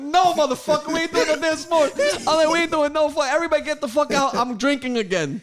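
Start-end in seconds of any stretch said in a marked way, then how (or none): 0.0-2.2 high, loud retold outburst; mock fury. Retelling furious answer after loss. 2.5-5.4 high, loud ranting dismissal; mock fury. Retelling furious dismissal of guests.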